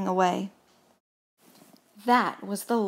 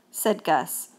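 ordinary delivery, nothing marked

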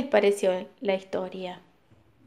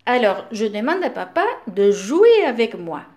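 ordinary delivery

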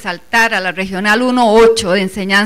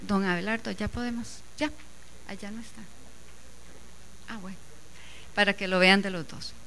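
much softer, off-mic aside; quiet aside; 2 semitones lower, 17 dB quieter, faster than usual. Real, not acted. Speaker checks with Don Abelardo before presenting, quiet aside. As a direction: drop the voice right down for a practical check.

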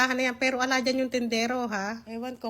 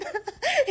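high burst of laughter; 13 semitones higher than usual. Real, not acted. Laughs at the quarrel story.

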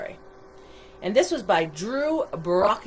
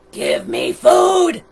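slow, high, loud demand; demanding monster; 8 semitones higher, 10 dB louder, much slower than usual. A narrator's reading. Monster demanding food from townspeople, slow loud shout. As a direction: bellow it like a starving monster.

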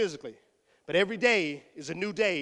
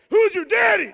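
much higher, loud hype cry; hype shout; 11 semitones higher, 11 dB louder than usual. Acted. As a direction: pump up the crowd. Hyping student on stage.

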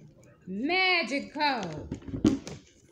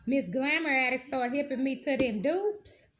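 normal talking voice

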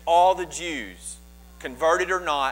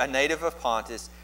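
ordinary delivery

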